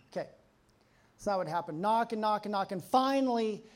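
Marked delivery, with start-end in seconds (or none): none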